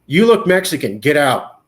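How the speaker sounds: loud, curt cop command; mock cop order. Officer ordering the driver out, loud command. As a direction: bark it like a cop.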